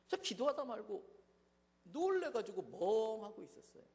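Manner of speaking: ordinary delivery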